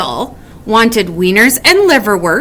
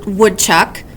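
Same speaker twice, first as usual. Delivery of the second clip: usual speaking voice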